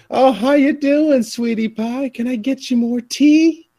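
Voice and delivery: high, slow Southern sweetness; southern waitress. Retold or imitated. A Southern waitress greeting a customer, sugary drawl. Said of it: "especially if you go down to the southern U S".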